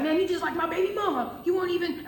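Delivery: high-pitched whiny accusation; worked-up mock complaint; mock hissy fit. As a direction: imitate an exasperated coworker ranting. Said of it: "starts getting a fucking hissy fit".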